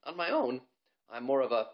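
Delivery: usual speaking voice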